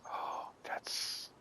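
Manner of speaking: hushed to himself; whisper. Real, not acted. Player judging his edit, whispered. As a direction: whisper it, focused.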